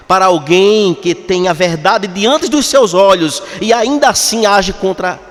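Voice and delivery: fervent preaching. Acted. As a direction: preach it out to the whole church with fire. Preaching at full force.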